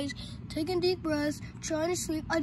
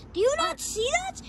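very high-pitched panic; frantic high-voiced alarm; panicked question; 12 semitones higher, 3 dB louder than usual. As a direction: cry it out, desperate to be believed. Panics as nobody sees the shadow.

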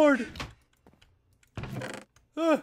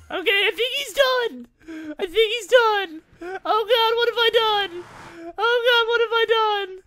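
whiny wail; 7 semitones higher than usual. Real, not acted. Lament after loss, crying sound.

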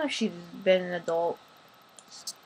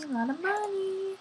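mock shock; 10 semitones higher, 4 dB quieter than usual. Repeats higher, louder.

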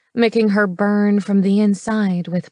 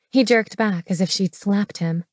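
plain reading voice

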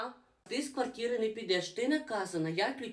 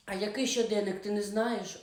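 ordinary delivery

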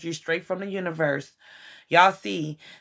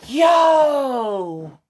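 surprised yell; 5 semitones higher, 3 dB louder than usual. Real, not acted. Sudden reaction to a card.

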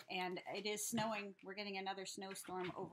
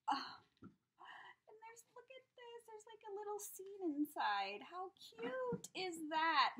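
delighted cooing; 11 semitones higher, slower than usual. Real, not acted. Showing a little scene inside, delighted cooing. Gushing over a tiny gift.

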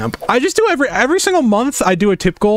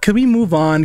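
ordinary delivery

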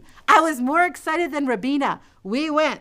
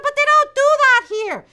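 put-on high, loud protesting girl; arguing with her mother; high insistent child's voice; 7 dB louder than usual.

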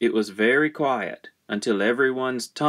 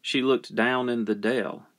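usual speaking voice

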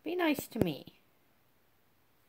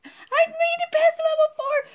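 high, loud, slow victory shriek; drawn-out ecstatic squeal; triumphant squeal; 10 dB louder than usual. Real, not acted. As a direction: stretch it out in pure delight. Triumphant cry after win.

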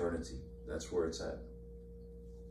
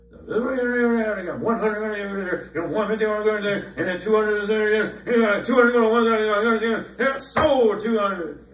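much higher, louder auctioneer chant; cattle auctioneer; 12 semitones higher, 11 dB louder than usual. Retold or imitated. Preacher mocking fast talkers as a cattle auctioneer calling bids. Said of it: "sound like the auctioneer at the cattle auction".

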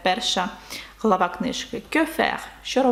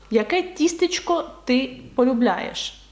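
ordinary talk, nothing marked

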